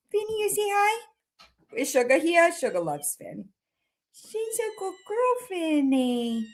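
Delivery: very high, soft cooing. Real, not acted. Owner cooing to her frightened cat, very high and soft.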